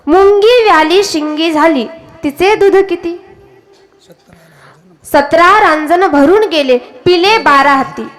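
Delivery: slow, measured verse chant; riddle verse recitation. Acted. Preacher quotes Nath's riddle abhang to listeners, slow recitation. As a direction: draw out each line of the verse. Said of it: "नाथांचा अभंग एक कूट अभंग आहे".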